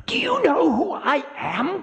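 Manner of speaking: very high, thin outraged cry; high, whispery shriek of wounded pride; indignant cry. Acted. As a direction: cry out in high-pitched affront. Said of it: "cried Kala".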